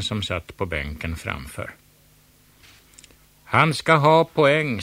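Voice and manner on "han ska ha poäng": much higher, louder, slow yell; loud crowd protest; crowd shouting. A narrator's reading. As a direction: shout down the judge. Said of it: "skrek hela publiken".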